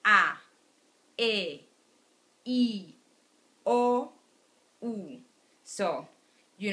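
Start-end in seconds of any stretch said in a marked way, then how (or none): 0.0-5.2 A E I O U said clearly one by one; vowel demo. Shown as a demonstration. Teacher to students, modeling the five vowels slowly. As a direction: model each Spanish vowel, mouth wide. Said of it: "my mouth is wider".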